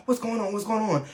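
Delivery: put-on aunt pitching up high in alarm at the crying kid; worried aunt. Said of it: "and she's like".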